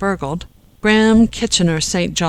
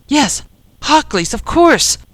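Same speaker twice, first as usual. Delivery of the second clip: much higher-pitched burst of realisation; excited agreement; 9 semitones higher, 5 dB louder than usual. A narrator's reading. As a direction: agree eagerly as it dawns on you. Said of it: "he agreed".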